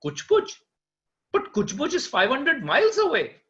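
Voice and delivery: high, loud disbelieving question; astonished. Acted. Marksman astonished the horse race is so far, surprised. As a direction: react in amazement at the distance.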